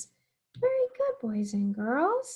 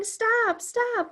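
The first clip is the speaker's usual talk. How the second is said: higher, mock children's shout of stop; kids yelling stop; 10 semitones higher than usual. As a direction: call stop like the eager kids, lightly. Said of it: "some of you are yelling".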